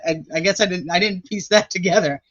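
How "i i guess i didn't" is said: normal talking voice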